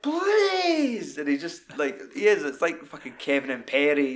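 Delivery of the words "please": high whiny plea; stroppy teen whine. Retold or imitated. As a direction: whine like a sulky teenager. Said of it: "like a stroppy teenager".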